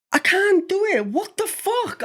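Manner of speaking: put-on high squeaky child, frustrated; kid's high voice. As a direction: squeal it like a cross little boy. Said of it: "Twenty seconds later".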